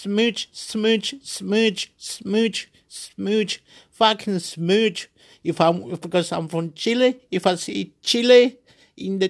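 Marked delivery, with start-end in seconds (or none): 0.0-5.1 imitated smooching brain. Imitating his brain at sport.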